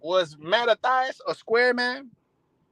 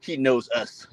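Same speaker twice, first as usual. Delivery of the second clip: mock cheesy slogan; 7 semitones lower, slower than usual.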